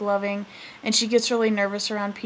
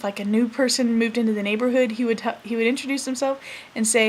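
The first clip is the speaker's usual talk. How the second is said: normal talking voice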